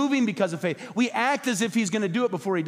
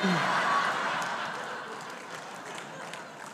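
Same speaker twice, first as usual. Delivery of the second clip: breathy laugh; 6 dB quieter than usual. Real, not acted. Laughing at own joke.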